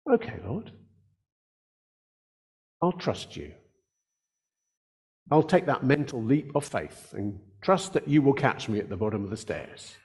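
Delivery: quiet, hushed reply to the Lord; a hushed voice of trust; soft humble trust. Retold or imitated. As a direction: say it softly, like a man surrendering. Abram's humble reply to God.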